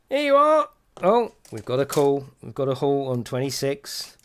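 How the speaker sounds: excited high bingo shout; bingo caller. Acted. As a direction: call out a winning card. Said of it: "meant to be a bingo call".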